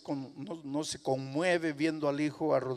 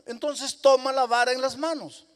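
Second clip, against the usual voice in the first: insistent exchange; 10 semitones higher, 8 dB louder than usual. Acted. Rising exchange, loud high.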